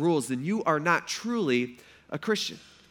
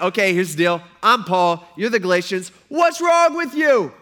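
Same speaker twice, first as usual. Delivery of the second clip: exasperated Paul; 2 semitones higher, 8 dB louder than usual. Acted. Preacher voicing Paul's angry letter.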